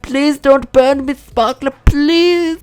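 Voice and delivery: high, drawn-out mock begging; comic high-pitched plea; pleading. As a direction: beg the sparkler in a squeaky, stretched voice. Pleading with a burning sparkler.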